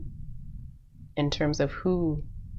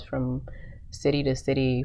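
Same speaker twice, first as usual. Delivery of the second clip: ordinary talk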